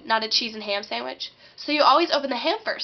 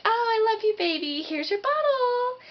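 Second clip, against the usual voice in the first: put-on little girl cooing; 10 semitones higher than usual. Little girl playing with her baby doll, high sing-song cooing. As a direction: coo sweetly to a doll, pitched way up. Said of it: "little girls play with baby dolls".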